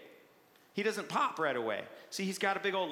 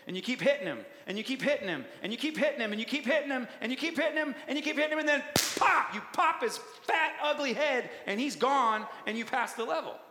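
from steady preaching to high, loud triumph; mounting excitement rising higher and louder to a triumphant peak; building excitement; 6 semitones higher, 4 dB louder than usual. Acted. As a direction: climb with every repeat till the pop.

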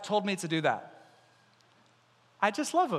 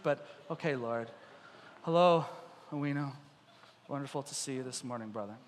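low, soft, grudging greeting; mock-dutiful mumbled politeness; reluctant obedience; 6 semitones lower, 7 dB quieter than usual. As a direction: greet him quietly, as an unwelcome duty.

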